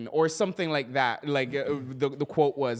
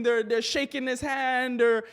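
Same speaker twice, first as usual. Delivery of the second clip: ordinary talk, nothing marked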